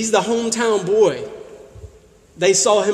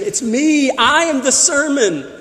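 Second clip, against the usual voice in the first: loud, higher-pitched proclamation; emphatic declaration; 8 semitones higher, 6 dB louder than usual. Retold or imitated. Preacher voicing Jesus declaring himself the sermon, emphatic and loud.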